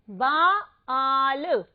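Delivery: very slow, drawn-out बाल; stretched model word. Shown as a demonstration. Teacher reading the word aloud, slow and stretched. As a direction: stretch बाल out slowly for the class. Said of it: "यहाँ पर हम शब्द पढ़ते हैं".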